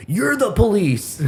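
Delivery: high, slow taunting retort; mocking neighbor. Neighbor taunting the teller, mock sneering. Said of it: "I'm gonna call the fucking police".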